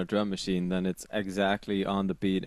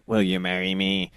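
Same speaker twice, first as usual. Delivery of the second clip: louder mock robotic repeat; 5 dB louder than usual. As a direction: imitate the robotic backing vocal. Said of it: "I can't do a good impression".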